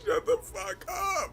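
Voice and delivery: high slow laugh-cry; laughing disbelief. Real, not acted. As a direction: wheeze it out in disbelief.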